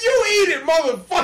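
high, loud mock command; retold aggression. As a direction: imitate someone snapping back in a quarrel. Acted sandwich argument.

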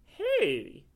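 high, loud delighted cry; surprised exclamation. Acted. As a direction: exclaim on the first taste. Cat exclaims on tasting tea.

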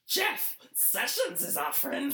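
high, slow creature whine; Smeagol voice. Retold or imitated. McConnell insisting Sessions is a friend, high Gollum whine. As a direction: plead like a clingy cave creature. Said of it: "sound like Smeagol".

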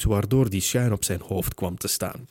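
ordinary delivery

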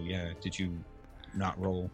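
normal talking voice

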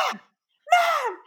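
put-on very high, loud child's yell; imitated yell. Mother imitating her daughter yelling in her sleep, yelled. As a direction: shriek for mom like a kid. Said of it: "yells at me like that".

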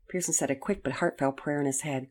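ordinary delivery, nothing marked

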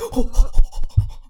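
ज़ोर से, डरकर साँस खींचना; अचानक चौंककर हाँफो; डर की हाँफ. Retold or imitated. Scared by a shifting pan.

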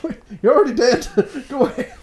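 high, loud laughing protest; mock protest. Reacting to a dead character turning up, high laughing protest. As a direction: shoo them off in high mock disbelief. Acting out reply to surprise cameo.

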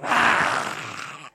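loud guttural roar; prayer roar. Retold or imitated. Pastor imitating his own fierce prayer roar. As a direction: roar out like casting a demon. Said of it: "I'm like".

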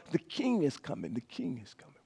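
hushed encouragement fading away; whisper. Acted. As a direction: start voiced, sink to a near whisper. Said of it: "that whisper".

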